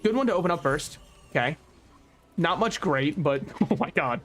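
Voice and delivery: usual speaking voice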